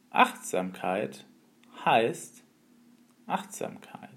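slow, fading into a whisper; wise old sage. Acted. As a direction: serene sage, ending in a whisper. Master's final calm answer.

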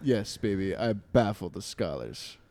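mock seductive. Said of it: "then you can say".

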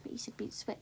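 whispered aside. Real, not acted. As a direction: whisper it off-mic to your partner. Side remark to co-host.

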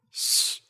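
voiceless "shh"; hushing shush. Acted. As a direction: shush her so nobody wakes. Hushing sister, parents asleep. (嘘声示意安静)

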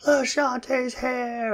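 put-on a little boy thrilled his sitter is here; excited kid. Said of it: "super excited to see her".

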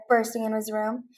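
plain reading voice

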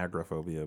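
"agoraphobia" as AG-ruh-PHOBIA; mispronunciation. Shown as a demonstration. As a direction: quote the odd AG-ruh version of "agoraphobia". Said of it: "I've never heard it pronounced".

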